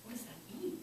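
soft, questioning lift; pondering brain. Acted. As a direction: wonder it quietly to yourself. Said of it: "Your brain is supposed to go".

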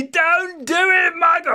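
very high, slow shouted warning; frantic little voice. Acted. Conscience pleading with boy to stop, frantic. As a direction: shriek the warning, stretching it. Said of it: "the little voice said".

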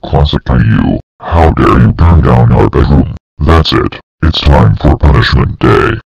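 very low booming scold; deep angry yell. Acted. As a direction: roar it low and loud. Deep voiced father scolds.

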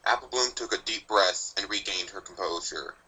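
plain reading voice

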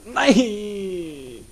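desperate shout. Real, not acted. Character dying.

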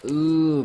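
loud disgusted ooh. Real, not acted. Gory chop reaction.

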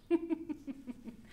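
short string of giggles; light laugh. Real, not acted. Teacher amused after a children's rhyme, light giggle.

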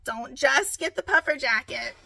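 ordinary talk

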